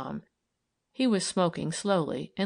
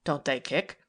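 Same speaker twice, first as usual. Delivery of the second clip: lowered male voice, blunt; a hint of a skeptical man; Tom's voice; 3 semitones lower than usual. Tom questioning abruptly.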